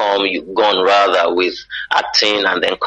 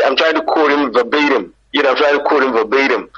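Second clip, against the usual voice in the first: higher-pitched put-on American English; American accent; 5 semitones higher than usual. Nigerian switching accent to be understood abroad, American accent. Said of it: "so you have to laugh".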